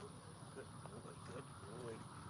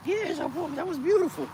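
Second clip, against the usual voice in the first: much higher, loud excited whoop; thrilled cheer; 14 semitones higher, 10 dB louder than usual. Real, not acted. Cheering the dog's surprise flip, high and thrilled. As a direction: celebrate like you just won.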